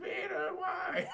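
high slow Aunt May call. Retold or imitated. Aunt May calling after him.

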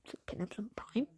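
fast, breathless whisper; a hushed, rushed request for Prime; whispered plea. Acted. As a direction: sneak the ask out in a hush. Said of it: "I'm trying to ask nicely".

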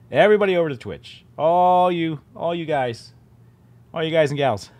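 loud, fast call to the viewers; calling everyone over. Real, not acted. Streamer calls out to viewers, loud and quick. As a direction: herd the whole chat over like a host rounding people up.

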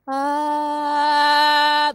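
opaque sustained ah; dull ah. Shown as a demonstration. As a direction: sing it dull, no ring.

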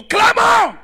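high, very loud exclamation; explosive preacher's cry; sudden shout. Real, not acted. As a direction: belt it out at the congregation. Preacher cries out the key word.

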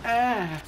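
sharp high cry; high yelp. Real, not acted. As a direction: yelp as he gets hit. Hurt in the game.

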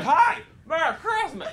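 high, thinned-out yell from far away; distant yell. Retold or imitated. Stranger across the street greeting him, mock high yell. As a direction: call it across the street without real volume. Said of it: "They would yell it from across the street".

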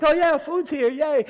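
high, loud, cheering for the food; a crowd of hungry kids hammed up, high and loud; mock cheer. Mission team cheering the food's arrival, hammed-up high cheer.

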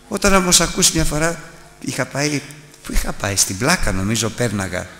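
normal talking voice

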